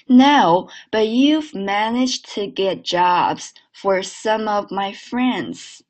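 slow sentence with the t and d endings held unreleased; swallowed stops. Shown as a demonstration. Teacher leading a repeat, stops swallowed, slow. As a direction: swallow the final t in "get", link "some of". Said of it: "的、t这些都吞掉啊".

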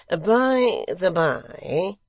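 high, very slow, drawn-out aside; secretary, casual. A narrator's reading. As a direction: stretch it out loftily as the secretary.

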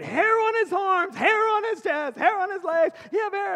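put-on very loud, high, drawn-out child's wail; hammed-up horrified little girl; alarmed child. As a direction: cry it out slowly in broken child English. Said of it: "And she said".

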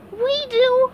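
high, timid little answer; meek SpongeBob. Acted. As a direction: squeak it out nervously. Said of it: "SpongeBob said".

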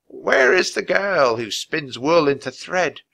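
put-on high, cracked old woman's chant. A narrator's reading. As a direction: intone it like an eerie old crone. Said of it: "In a cracked voice she said".

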